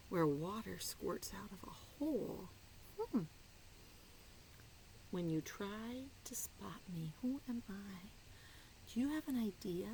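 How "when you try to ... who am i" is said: secret whisper. Acted. Whispered riddle question.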